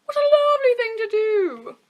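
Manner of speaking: high, loud delighted exclamation. Acted. The wolf pretends to admire the girl, exclaiming loudly. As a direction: gush with fake delight. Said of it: "exclaimed the wolf".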